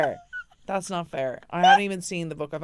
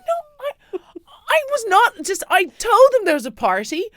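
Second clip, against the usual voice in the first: much higher, loud, tearful mock protest; wailing protest; 12 semitones higher, 10 dB louder than usual. Wife protests innocence to her husband in a high mock wail. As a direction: cry out your innocence in a hammed-up wail.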